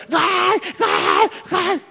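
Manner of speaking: imitación de niños, gritos muy agudos; niños gritando gol. Retold or imitated. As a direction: mimic kids yelling at a football game, high-pitched. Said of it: "los niños están abajo gritando". (niños gritando gol, voz aguda)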